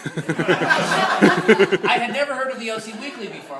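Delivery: laughing through the line, slow and loud; can't keep a straight face telling it. Real, not acted. Telling the audience how he found the paper, laughing.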